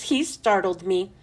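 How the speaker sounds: ordinary delivery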